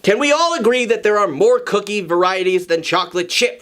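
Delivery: usual speaking voice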